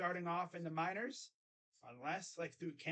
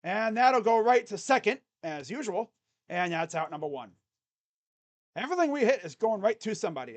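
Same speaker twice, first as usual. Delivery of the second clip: loud broadcaster call of the grounder; play-by-play call; 4 semitones higher, 11 dB louder than usual. Acted. Fan narrates the out to viewers, loud announcer style. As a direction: shout the play like a radio announcer.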